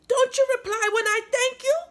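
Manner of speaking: a fairy snapping in outrage at the silent doll; angry fairy cry. A narrator's reading. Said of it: "cried the angered fairy".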